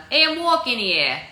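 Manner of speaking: loud, high New York accent. Shown as a demonstration. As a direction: call it out like an indignant New Yorker. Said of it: "New York accent".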